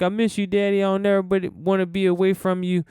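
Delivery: a hint of a tearful child missing her dad; daughter's plea. A hint of a tearful daughter telling her father she missed him.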